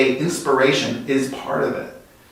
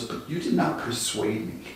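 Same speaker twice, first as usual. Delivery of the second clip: menacing quiet; 10 dB quieter than usual. Retold or imitated. Dictator quietly threatens his advisor, menacing. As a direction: say it softly so it chills.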